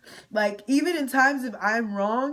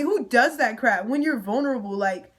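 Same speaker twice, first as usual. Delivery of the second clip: ordinary talk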